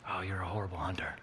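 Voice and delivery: soft, hissing whispered accusation; a sneering whisper in his ear; voice of the enemy. Retold or imitated. The enemy's lies whispering to a discouraged father, a sneering whisper. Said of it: "The lies of the enemy started coming".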